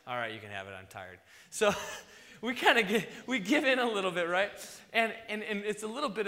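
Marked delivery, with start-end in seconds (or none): 0.1-1.2 tired giving in. Retold or imitated. Parent giving in to pestering kids.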